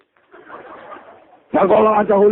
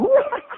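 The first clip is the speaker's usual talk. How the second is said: sudden high laugh. Real, not acted. Preacher laughing as he recalls the joy. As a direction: let a high laugh burst out. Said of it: "kok bisa ketawa saya ini".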